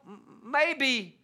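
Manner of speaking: usual speaking voice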